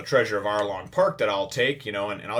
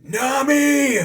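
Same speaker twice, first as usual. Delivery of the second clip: loud, much higher joyful yell; shouting Nami; 11 dB louder than usual. Retold or imitated. Luffy calls out to Nami, loud high shout. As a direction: bellow her name across the rubble. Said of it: "he just goes".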